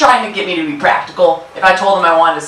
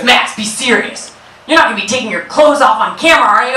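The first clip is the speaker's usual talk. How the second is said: stern dad; 6 semitones higher than usual. Retold or imitated. Dad voiced stern then rushed.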